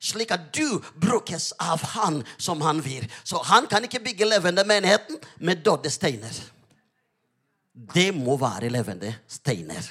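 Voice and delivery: emphatic, punched sermon delivery; fervent preaching. Real, not acted. Preacher urging the congregation to let God use them, fervent preaching.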